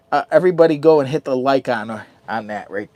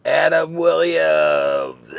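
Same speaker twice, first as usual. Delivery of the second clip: drawn-out name call; 3 semitones higher, much slower than usual. Real, not acted. Calling out a donor's name.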